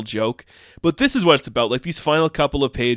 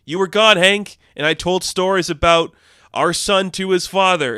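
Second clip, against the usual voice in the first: raised scolding voice; 7 semitones higher, 4 dB louder than usual. Retold or imitated. Greer scolding Hank.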